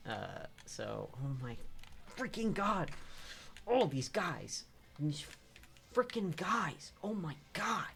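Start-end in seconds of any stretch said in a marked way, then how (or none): 2.1-7.9 high, loud frustrated yelling; furious gamer rage. Real, not acted. Streamer raging at the enemies in the game, shouting furiously. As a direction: explode at the screen in real anger. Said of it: "I'm so mad!".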